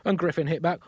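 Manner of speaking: ordinary talk, nothing marked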